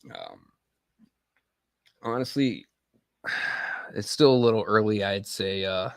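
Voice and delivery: ordinary delivery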